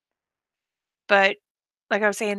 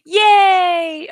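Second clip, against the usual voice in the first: gasp, then a high loud yay; delighted cheer; 9 semitones higher, 11 dB louder than usual. Real, not acted. Thrilled that a viewer takes the link, with a high loud cheer. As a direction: burst out in real delight. Someone finally joins the link.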